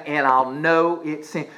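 ordinary talk

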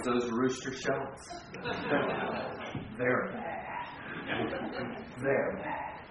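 very low, hushed cartoon kid's warning; imitating a cartoon child, low and soft; Angelica. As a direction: drop to an ominous, quiet mutter with a chuckle. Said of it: "Angelica told him".

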